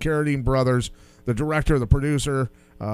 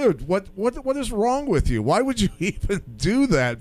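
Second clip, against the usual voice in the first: exasperated retort; 5 semitones higher than usual. Retold or imitated. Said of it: "But I go".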